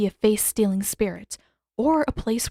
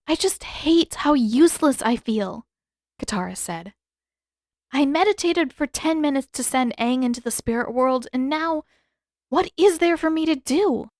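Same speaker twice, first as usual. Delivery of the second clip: higher, a touch of frustration; lightly voiced frustrated girl; 5 semitones higher than usual. A narrator's reading. Katara complaining to Zuko, lightly voiced frustrated girl. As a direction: let her complain about feeling useless.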